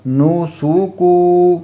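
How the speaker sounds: نو سو کو with every vowel stretched slowly; wrong long vowels. Shown as a demonstration. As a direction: show the mistake by dragging out نو سو کو. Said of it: "وہ کیسے پڑھتے ہیں آپ کو بتاتا ہوں". (نو سو کو غلط، آواز لمبی کھینچ کر)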